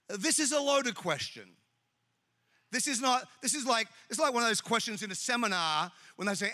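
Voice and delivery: usual speaking voice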